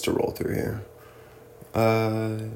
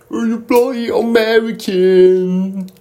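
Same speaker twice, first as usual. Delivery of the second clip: high, loud, slow British sneer; mock British taunt; 10 semitones higher, 5 dB louder than usual. Brits taunting an American, in a hammed-up British accent. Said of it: "taunt people like that".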